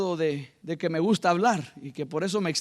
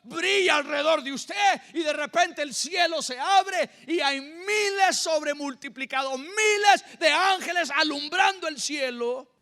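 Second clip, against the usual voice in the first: preacher proclaiming, raised pitch; prédica enérgica; 7 semitones higher than usual. Real, not acted. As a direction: preach it with rising fervor, stretched key words. (predicación fervorosa en voz alta y aguda)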